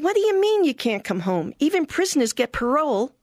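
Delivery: mock an outraged mom; indignant mother. Mother scolding daughter who won't come home, indignant. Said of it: "And she said".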